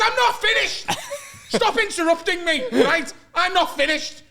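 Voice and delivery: high, loud, indignant cut-in; mock angry interruption. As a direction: cut him off like a fuming flatmate. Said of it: "he's just ranting at me and he's screaming".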